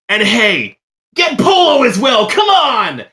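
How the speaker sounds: very high, loud eager shout; excited call. Acted. Calling Shay to fetch Polo, very high and loud. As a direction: call out to the crowd, thrilled. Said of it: "Shay, and goes".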